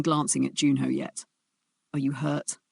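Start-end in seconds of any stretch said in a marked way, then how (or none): 1.9-2.6 softer, gentle question to a hurt woman. Acted. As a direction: ask softly, almost under the breath. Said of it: "His voice was gentle".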